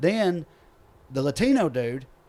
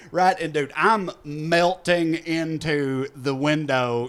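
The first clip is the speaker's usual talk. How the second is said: ordinary delivery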